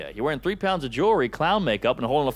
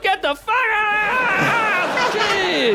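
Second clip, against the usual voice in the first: high, drawn-out comic shriek; imitated outraged scream; 4 dB louder, much slower than usual. Woman caught on the toilet, imitated outraged scream. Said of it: "She was like".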